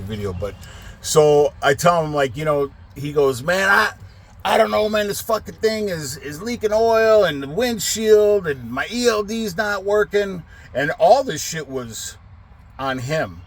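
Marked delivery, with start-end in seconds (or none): 3.4-10.4 high-pitched mock complaint; retold grumble, pitched up; griping driver. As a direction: imitate a driver griping on the phone.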